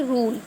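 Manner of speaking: रूल with a long, stretched "oo"; long "oo" held in रूल; drawn-out vowel. Shown as a demonstration. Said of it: "खींच के पढ़ेंगे".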